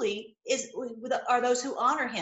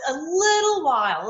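excited cry; 4 semitones higher, 6 dB louder than usual. Real, not acted. Preacher cries out in joy.

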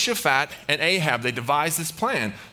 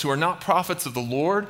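normal talking voice

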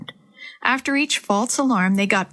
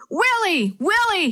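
excited hollering; 10 semitones higher, slower than usual. A narrator's reading. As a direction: holler the name, pitched way up. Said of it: "as he hollered".

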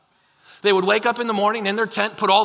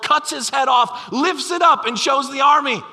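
building climax; 6 semitones higher, 4 dB louder than usual. Acted. Preacher's climax of the story.

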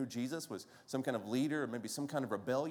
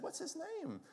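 put-on high, softer Brooklyn-accented question; 5 dB quieter than usual. Grandmother's friend asking about the baby, imitated Brooklyn accent. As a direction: imitate an elderly Brooklyn woman, pitched up. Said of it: "She had this great Brooklyn accent".

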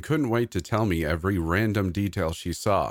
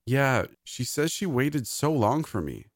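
put-on high-pitched small-child voice; happy little girl; 7 semitones higher than usual. A narrator's reading. Maisie reports what Olivia told her, high child voice. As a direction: gush a bit, like a small child.

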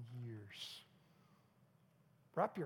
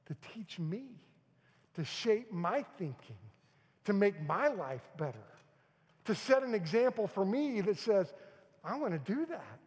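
louder, rising pulpit build; building preaching; 5 semitones higher, 8 dB louder than usual. Acted. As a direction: build each phrase up. Building sermon list.

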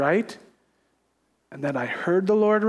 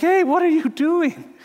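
high, sharp mock alarm; a startled protest to Jesus; 8 semitones higher than usual. Startled at Jesus overturning tables.

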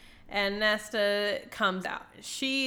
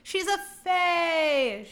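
higher, slow, drawn-out announcement; mock shocked reveal; 8 semitones higher, much slower than usual. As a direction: stretch it out like a scandalous reveal. Retold shocked reveal in recap.

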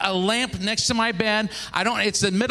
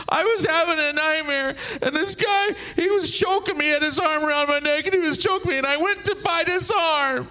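high, hammed-up tearful wail; wife catching breath; 10 semitones higher than usual. Wife explaining nightmare to husband, mock breathless crying. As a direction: wail out the excuse, pitched high, slowing at the end. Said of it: "she's trying to catch her breath".

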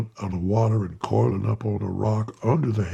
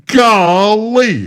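sudden loud, much higher bellow of amazement; 11 semitones higher, 10 dB louder than usual. A narrator's reading. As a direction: bellow it out in astonishment. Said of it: "he almost bellowed".

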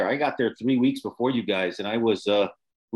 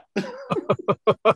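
burst of laughter; 6 semitones higher than usual. Real, not acted. Laughing at the story.

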